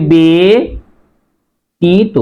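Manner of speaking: ব্যতীত stretched slowly into "beee... tito"; long e, then a pause in ব্যতীত; slow model "be, ti-to" for ব্যতীত; syllable by syllable. Shown as a demonstration. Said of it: "উচ্চারণ হবে".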